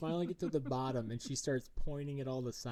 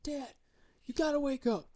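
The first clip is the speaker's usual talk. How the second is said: put-on high, loud little girl's urgent plea; excited daughter; 14 semitones higher, 7 dB louder than usual. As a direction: play the eager kid shaking dad awake, much higher and louder. Daughter voiced waking her dad.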